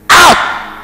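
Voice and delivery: commanding shout. Acted. Preacher voicing Jesus' command.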